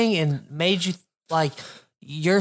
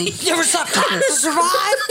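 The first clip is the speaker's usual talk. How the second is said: higher, laughing through the question; laughing line repeat; 7 semitones higher than usual. Shown as a demonstration. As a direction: replay the soundbite while cracking up. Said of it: "I'm putting that as a soundbite".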